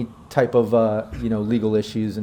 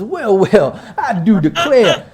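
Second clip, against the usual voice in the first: loud, higher Southern gentleman drawl; 8 semitones higher, 6 dB louder, slower than usual. Acted. Local boss hailing strangers in their vehicle, Southern drawl. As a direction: stretch it into a grand Dixie drawl. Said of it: "my southern drawl got a little bit of that Dixie charm".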